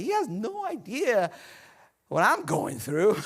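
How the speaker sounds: slow, drawn-out disbelief, pitch jumping up; mock incredulous. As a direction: stretch it out as an exasperated inner complaint. Acting out his own old doubt.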